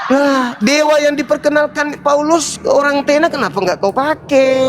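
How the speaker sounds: high, amused jeer; laughing mockery. Real, not acted. Mocking with laughter.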